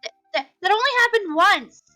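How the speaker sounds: stuttering, high, loud denial; sputter, then burst out defensively; flustered protest. Acted. Friend denying what the boy just said, flustered outburst.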